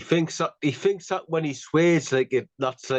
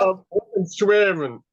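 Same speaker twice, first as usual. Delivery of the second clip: mock Shearer; 3 semitones higher, 4 dB louder than usual. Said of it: "he's like".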